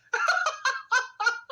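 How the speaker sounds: high burst of laughter; scoffing laugh. Real, not acted. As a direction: crack up at the absurd claim. Laughs at the psychology claim.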